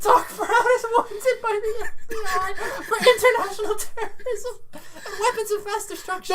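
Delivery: high-pitched words through giggles; really laughing while talking. Real, not acted. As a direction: struggle through the list while cracking up. Cracking up at own joke.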